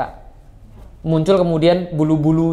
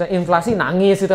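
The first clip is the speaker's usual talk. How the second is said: normal talking voice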